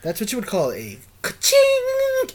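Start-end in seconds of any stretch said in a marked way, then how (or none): 1.2-2.3 slow, high announcer-style ka-ching; cash register ka-ching. Pretend. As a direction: drawl out ka-ching like a sound effect. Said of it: "we need drops".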